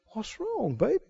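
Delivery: high, hushed coaxing; hushed doting coo; cooing wife. Acted. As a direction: coax him in a soft sweet voice. Jezebel cooing retold.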